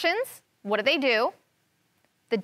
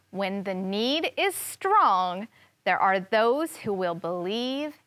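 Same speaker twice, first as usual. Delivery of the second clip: ordinary talk, nothing marked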